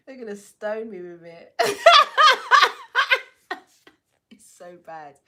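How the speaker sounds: laughing at her own bad accent; giggling fit. Real, not acted. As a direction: crack up mid-sentence. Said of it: "Getting my Jamaican accent ready".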